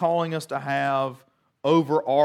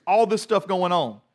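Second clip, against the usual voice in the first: ordinary talk, nothing marked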